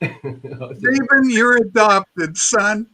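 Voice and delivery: slow, half-crying fatherly line; mock tearful father. A father in The Jerk telling Stephen he's adopted, mock crying. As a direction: break the news through mock tears. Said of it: "quoting The Jerk".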